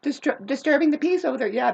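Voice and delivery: ordinary talk, nothing marked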